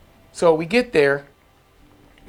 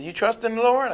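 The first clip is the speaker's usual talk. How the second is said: put-on higher, lilting elderly church mother; 9 semitones higher than usual. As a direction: pitch it up like a kindly church mother. Church mother speaks on phone.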